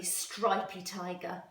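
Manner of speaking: ordinary delivery, nothing marked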